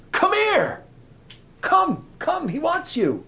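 high, loud, excited calling; urgent summons. Acted. Disciples fetching blind Bartimaeus for Jesus, urgent calling. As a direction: call him over eagerly at full voice.

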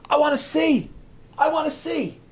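high, urgent cry; desperate plea. Acted. Blind man begging Jesus for sight, desperate plea. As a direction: beg loudly from the gut.

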